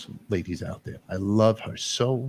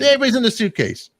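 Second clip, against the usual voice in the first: higher and louder, gleefully absurd; excited punchline; 11 semitones higher, 7 dB louder, faster than usual. Real, not acted. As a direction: sell the joke with a burst of energy. Joking about flying with ashes.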